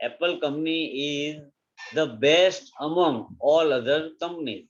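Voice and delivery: slow dictation. Shown as a demonstration. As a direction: slowly read example sentence. (धीरे-धीरे लिखवाना)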